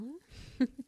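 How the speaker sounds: small, high giggle. Acted. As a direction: giggle lightly to yourself. Teasing her patient fondly.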